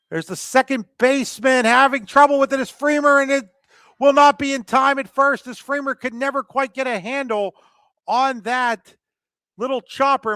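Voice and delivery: high, loud live call; excited play-by-play. Real, not acted. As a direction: call the fumbled play with rising urgency. Live call of fielding trouble.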